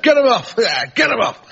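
high mock shout; imitated drunken heckler's shout. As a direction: heckle the act from the audience. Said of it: "this fella shouted out".

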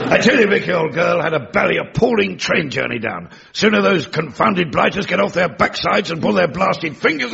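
clipped upper-class English bluster, lightly done; Albert putting on a plummy English gentleman; Albert showing off new English elocution to Victoria; elocution-lesson English. Acted. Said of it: "have you been taking elocution lessons?".

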